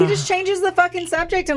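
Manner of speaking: high, exasperated disbelief; incredulous. Real, not acted. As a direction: scoff at his dodge. Incredulous retelling.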